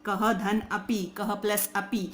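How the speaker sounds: slow split. Shown as a demonstration. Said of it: "संधि विच्छेद करते हैं तो ये ऐसे है".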